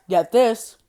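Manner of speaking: ordinary delivery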